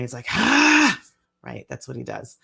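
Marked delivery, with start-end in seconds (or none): 0.3-1.0 imitating a frustrated child's cry, much higher and louder; mock exasperated "Ah!" of a tired son; a mother imitating her son's frustrated outburst; frustrated kid's yell. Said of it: "then he gets really frustrated".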